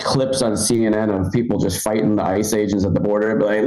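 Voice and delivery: ordinary talk, nothing marked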